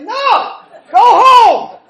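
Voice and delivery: very loud, high comic outburst; hammed-up exasperated bellow; acted shouted refusal. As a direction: yell your inner protest for laughs. Acts out his inner refusal.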